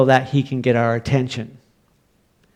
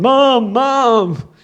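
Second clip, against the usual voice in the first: hollering; 10 semitones higher than usual. Retold or imitated. Frightened boy hollering down the stairs to mom, high yell. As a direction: yell for mom like a scared kid. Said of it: "hollering down".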